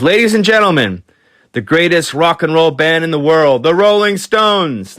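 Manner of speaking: high, big announcer voice; announcer introduction. Retold or imitated. [name] introducing the Stones onstage, big announcer voice. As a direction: hype the crowd, stretch the band name. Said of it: "he says".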